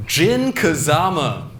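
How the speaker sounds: slow, drawn-out announcer voice; game announcer. Acted. Comic mimicking the Tekken select screen, slow announcer call. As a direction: stretch each name like a character select screen. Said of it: "my impression of the Tekken character select voice".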